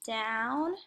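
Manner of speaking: DOWN held long, rising at the end; drawn-out DOWN. Shown as a demonstration. Teacher modeling how the lowercase I goes, drawn-out DOWN. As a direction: stretch DOWN like a slow pen stroke. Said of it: "it goes like this".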